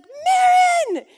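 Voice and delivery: high, loud shout across the house; child screaming a name. Retold or imitated. Little son yelling the neighbor's name out the window. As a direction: yell her name as she rolls past. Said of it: "Judah screams at you all the time".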